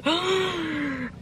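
long, soft, high mock-horrified gasp; shocked gasp. Reacting to her ninety-two pound spend, shocked gasp. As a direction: suck in air in mock horror. Shock at what she spent.

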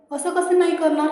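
a startled how-dare-you; indignant surprise. A narrator's reading. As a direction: protest with a sudden jump up. Indignant protest to lover.

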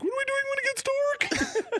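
high anxious mock panic; squeaky nervous question. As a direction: squeak it in alarm. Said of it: "I'm like".

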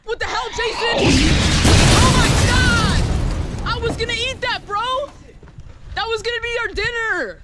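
high, loud outraged shrieks; outraged yelling. Real, not acted. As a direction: scream in disbelief at a friend. Friend grabs his dinner.

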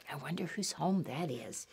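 soft, low near-whisper; hushed wondering. A narrator's reading. Storyteller wondering to children whose home it is, hushed. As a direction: drop to a hushed, curious aside. Hushed aside after the shouting.

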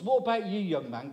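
ordinary talk

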